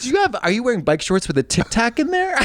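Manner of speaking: normal talking voice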